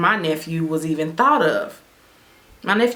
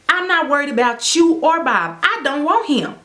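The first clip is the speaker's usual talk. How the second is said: heated comeback; 8 semitones higher than usual. Retold or imitated. She shoots back on phone.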